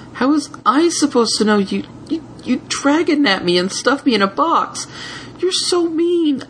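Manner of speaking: high, stammering outrage; sulky protest; indignant. Acted. Spike indignant.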